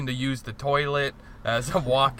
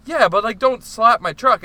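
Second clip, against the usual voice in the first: higher, louder retold protest; indignant warning; 8 semitones higher, 7 dB louder than usual. Truck owner protesting the slap, a higher, louder indignant warning. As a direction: scold him for slapping the truck. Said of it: "And he's like".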